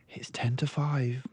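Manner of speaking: a hint of the gentle librarian; kindly librarian. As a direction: librarian calling time softly. Librarian tells Matilda time.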